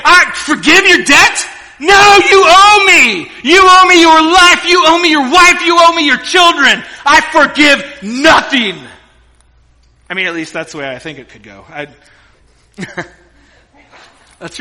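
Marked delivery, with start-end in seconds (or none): 0.1-8.8 furious king. Acted. King shouting at the servant he throttles, furious. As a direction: roar it at the choking servant.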